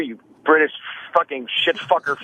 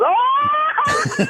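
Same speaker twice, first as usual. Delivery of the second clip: retold shout. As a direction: blurt out a mock cheering yelp. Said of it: "he goes".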